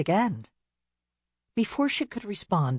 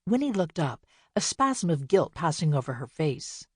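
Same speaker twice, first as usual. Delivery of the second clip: ordinary delivery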